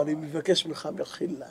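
hushed, retold begging for forgiveness; humbled apology. Rich man falls at the sage's feet, hushed pleading. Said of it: "בא, נפל לרגליו".

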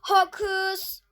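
loud playful incantation; mock spell. Gamer doing magic for luck, mock spell. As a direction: cast it like a hammed-up magician. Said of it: "bir hokus pokus yapacağım".

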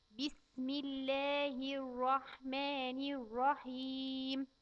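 put-on slow, careful recitation for a child to repeat. Shown as a demonstration. Teacher reciting slowly for a child to repeat. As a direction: spell out each word slowly for the child. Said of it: "ونبدأ ناخد أول آية معانا".

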